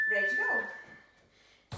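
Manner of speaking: loud, drawn-out slow call. Real, not acted. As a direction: stretch it out to cue the start. Coach cueing the start.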